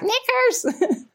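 very high, squawking parrot scream; screeching cockatoo. Retold or imitated. Kizzy the cockatoo screaming back at the zoo kids, high parrot screech. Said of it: "would scream back".

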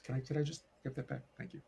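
usual speaking voice

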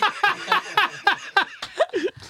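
high burst of laughter. Real, not acted. Laughing when asked about Ziggy Peelgood. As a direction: let out a high giggle.

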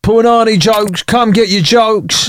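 singsong market seller; mock street vendor. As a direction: call out your wares, stretching the words. Mock vendor hawking crude jokes.

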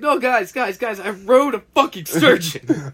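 high, eager pleading to be believed; excited insisting. Retold or imitated. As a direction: gush it like a proud kid. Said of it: "And I'm like".

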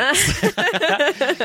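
loud, high embarrassed laugh; high burst of laughter. Real, not acted. Laughs at own weak accents.

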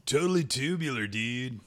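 high, loud cartoon surfer-dude voice; Michelangelo impression. Co-host asks for a Ninja Turtle, excited dude voice. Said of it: "give me a Michelangelo impression".

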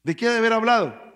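loud, high, fast; fiery preaching. Acted. Preacher challenging the congregation, loud and fast. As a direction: hammer the question at the congregation.

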